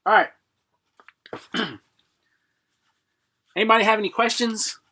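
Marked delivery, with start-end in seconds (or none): none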